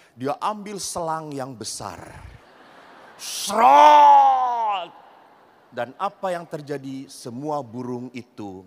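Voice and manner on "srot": a sudden loud spraying "srot"; hose spray noise. Retold or imitated. Preacher imitating the big hose blasting the birds, loud spray noise.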